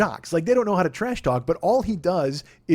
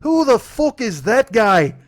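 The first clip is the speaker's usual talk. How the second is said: McGregor put-down; 6 semitones higher, 9 dB louder, much slower than usual. Retold or imitated. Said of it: "he goes".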